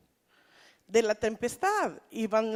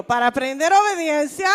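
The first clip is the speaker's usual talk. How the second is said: preacher leading the congregation, louder; loud call-and-response line; louder call to repeat; 2 semitones higher, 8 dB louder than usual. Shown as a demonstration. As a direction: say it out for them to echo. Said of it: "dígalo conmigo".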